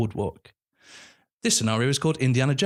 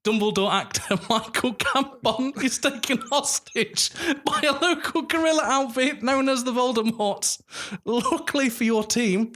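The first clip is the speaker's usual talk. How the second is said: laughing while reading; 11 semitones higher than usual. Real, not acted. Reading through giggles.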